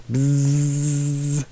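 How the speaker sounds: buzzing like a bee; a soft buzzing bee sound. Pretend. Imitating a bee.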